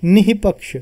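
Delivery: निहिपक्ष said with a breathy h sound from the throat; visarga pronunciation demo. Shown as a demonstration. Teacher reading निहिपक्ष aloud to show students the visarga sound. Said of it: "तो यहाँ पे कैसा पढ़ेंगे हम?".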